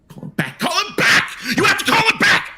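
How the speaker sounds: shrill high yell calling after them; a panicked patient begging them to come back; frantic shouting. Acted. Patient shrieks after the nurse as they leave. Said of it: "the voice fading".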